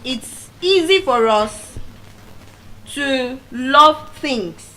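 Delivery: slowed down, stretching love things; slow emphasis. Shown as a demonstration. Speaker teaching listeners, slow and weighty. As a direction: lean slowly on each word. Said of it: "slow emphatic teaching".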